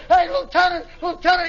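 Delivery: high, urgent mock yell; alarmed call. Crewman Johnson calling the lieutenant, alarmed high yell. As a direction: call for the officer in a panic.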